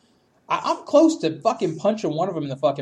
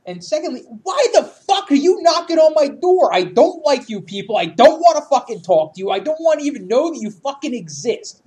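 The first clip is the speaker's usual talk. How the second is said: from annoyed to furious yelling, louder and higher; building angry rant; 5 semitones higher, 7 dB louder than usual. Retold or imitated. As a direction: rant at the neighbors through the door until you yell. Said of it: "And I'm like".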